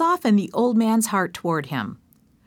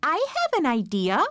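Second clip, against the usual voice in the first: higher, bright little chimp voice; eager chimpanzee; 7 semitones higher than usual. Acted. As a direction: pitch it up like a clever chimp. Chimpanzee proposes a plan.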